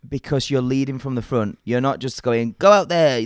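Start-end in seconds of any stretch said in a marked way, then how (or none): 2.6-3.2 louder, higher mock coach command; pushy mock instruction; calling out orders. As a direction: send them out with a raised voice. Acting out a bossy coach.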